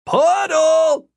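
long, high-pitched shout; loud, drawn-out call at a high pitch; yelling. A narrator's reading. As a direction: bellow it as a direction to someone far off. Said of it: "pointed back the way they'd come from and yelled".

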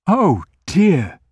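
put-on high, loud flustered old man; a flustered old grocer's oh dear; dismayed. A narrator's reading. Grocer realizing what the man fears, high and flustered.

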